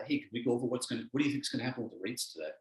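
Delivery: the doubting voice creeping in at dawn; muttered inner critic; negative inner voice. Retold or imitated. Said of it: "your negative voice goes".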